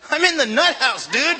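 high, loud comic protest; hammed-up incredulous yell; incredulous outburst. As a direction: shout it in disbelief, on the edge of laughter. Incredulous retold reply, comic.

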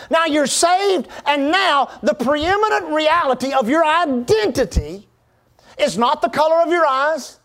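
driving sermon delivery with punched key words; emphatic preaching. Real, not acted. Preacher tells congregation of their new identity, emphatic preaching. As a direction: preach it in rising and falling phrases.

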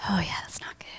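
very soft, low aside; hushed concern. Retold or imitated. Onlooker seeing nurses and doctors rush in, hushed concern. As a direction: say it under your breath, nearly whispered. Acted reaction to worried medical flurry.

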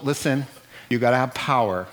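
ordinary talk

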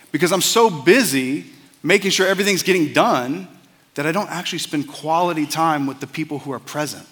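ordinary talk

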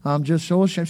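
low, small, mock-pitiful voice; ashamed whimper. Believer confessing shame to nobody, mock-pitiful. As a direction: sound beaten and ashamed, lightly.

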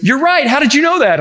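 high, amazed guide voice; surprised tour guide. Retold or imitated. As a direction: mimic the guide's astonished question, pitched high. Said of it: "And he goes".

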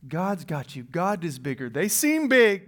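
ordinary delivery

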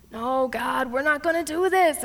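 sighing, then rising in protest; dismayed pleading. Acted. Gideon protesting to God over the shrinking army, dismayed pleading. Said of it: "he's probably just like".

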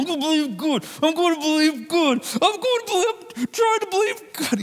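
high, strained voice of someone forcing belief; mock the anxious believer straining upward. Preacher mocks the anxious believer forcing faith, strained high voice. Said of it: "puts just more pressure on people".

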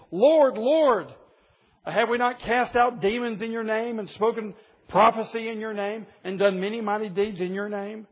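pleading crowd crying out to their Lord; desperate plea. Retold or imitated. People pleading with Jesus, quoted.

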